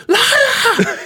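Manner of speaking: hammed-up squeal of the actress; Valerie bursting in. Podcaster mimics Valerie bursting into the scene, shrill. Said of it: "when she busts in with".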